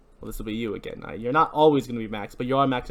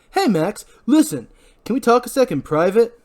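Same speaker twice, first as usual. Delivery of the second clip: loud; 11 semitones higher, 6 dB louder than usual. Acted. Barry confronting Max.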